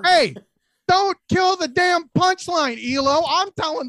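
loud, high, slow scolding; annoyed yell. Real, not acted. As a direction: snap at him to stop interrupting. Host scolds Elo for interrupting.

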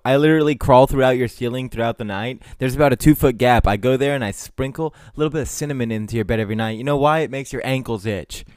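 loud, menacing mock taunt; mock threatening taunt. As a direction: taunt him loudly like a creepy stalker. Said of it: "just really fucking just going in on each other".

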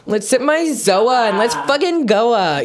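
put-on Valley girl voice. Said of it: "like a Valley girl saying it".

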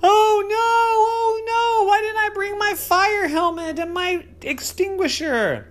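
put-on high, loud child's alarm; hammed-up panic; mock panic. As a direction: squeal it in pretend fright. Poppy panics at fireball.

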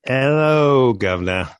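mock British "Hello, Governor" with a big high "Hello"; playful cockney-ish greeting; British accent attempt. Shown as a demonstration. Guest greeting the host in a mock British accent. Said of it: "could have done that with a better accent".

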